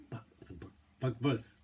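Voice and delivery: fumbled attempt at a German accent; bad German accent. Cook naming a sausage in a badly faked German accent. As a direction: try a German accent and stumble into it. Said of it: "I can't do a German accent".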